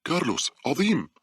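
high-pitched, delighted exclamation; relieved. A narrator's reading. Paula recognises Carlos in the dark, relieved and excited. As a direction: greet him with a leap of relief. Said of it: "شعرت باولا بارتياح وهي تقول".

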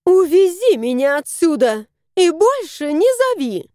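mother ordering her son to take her away; demanding. A narrator's reading. Мать требует у сына увезти её. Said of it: "Алевтина потребовала".

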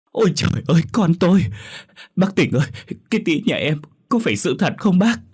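high, distraught whimpering cry; tearful sobbing. Acted. Stepmother faking faintness at the news, tearful sobbing. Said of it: "thút thít khóc".

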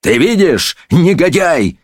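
high indignant outburst; furious judge. A narrator's reading. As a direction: round on the scoundrel in fury. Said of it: "бешено рявкнул судья".

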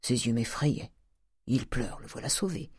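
soft private aside; talking to himself. Acted. Uncle watching his nephew weep, quietly talking to himself. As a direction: think aloud, well below speaking level. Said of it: "se dit Grandet".

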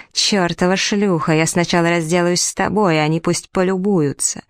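ordinary delivery, nothing marked

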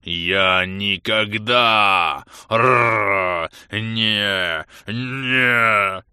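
loud, slow words torn by growls; broken roaring speech. A narrator's reading. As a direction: roar the words apart. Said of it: "Слова прервались".

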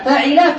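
فعل said fa-'i-la, middle vowel i; kasra on ain. Shown as a demonstration. Teacher listing verb patterns, فعل as fa'ila. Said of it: "له ثلاثة أوزان فقط".

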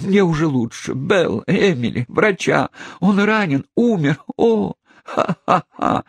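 high, shrill hysterical cries ending in laughter; rising panic breaking into a laugh. Acted. Hysterical aunt after the shot. (истерический вопль, переходящий в смех)

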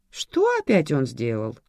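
ordinary talk

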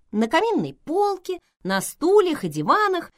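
usual speaking voice

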